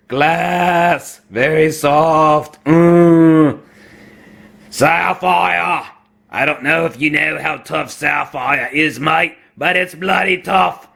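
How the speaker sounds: broad mock Aussie, vowels drawn out; Australian accent. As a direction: ham up a butchered Australian accent. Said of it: "my use of an Australian accent".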